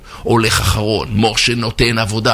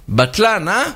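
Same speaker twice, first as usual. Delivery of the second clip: offended echo; 8 semitones higher than usual. Acted. Insulted manager echoes the insult.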